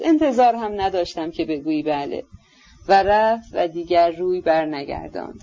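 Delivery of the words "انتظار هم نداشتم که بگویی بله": ordinary delivery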